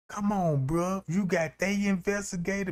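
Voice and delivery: ordinary delivery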